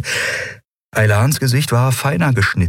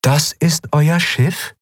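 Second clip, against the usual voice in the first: slow, higher-pitched disbelief; sceptical question; 9 semitones higher, slower than usual. A narrator's reading. Shayang eyes the ship in the storm, slowly sceptical. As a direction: draw the question out high, unimpressed.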